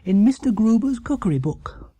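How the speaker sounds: the bear showing off his find; proud little bear. Acted. Paddington telling the Browns where he found the recipe, proud and high.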